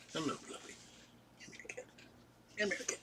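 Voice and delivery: whispered calls to the cat; hushed coaxing, real; quietly calling a cat over; soft coaxing. Real, not acted.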